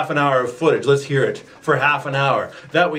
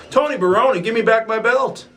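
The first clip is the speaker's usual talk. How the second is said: high whiny demand; 8 semitones higher, faster than usual. Acted. Demanding belt from rival.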